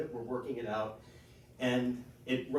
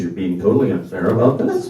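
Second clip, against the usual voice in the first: dog voice; 4 semitones lower, 12 dB louder than usual. Retold or imitated. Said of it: "My dog says".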